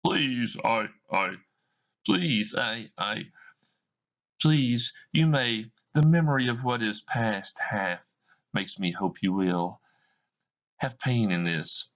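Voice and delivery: begging her to stay; a faltering desperate plea; stammering plea. Acted. Scrooge stammers, pleading.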